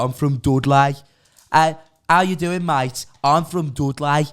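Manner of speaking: put-on Dudley accent. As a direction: ham up a thick Dudley accent to mock it. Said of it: "It's the fucking worst accent in the whole country".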